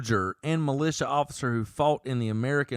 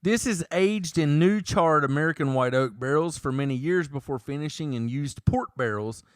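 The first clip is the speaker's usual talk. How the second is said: normal talking voice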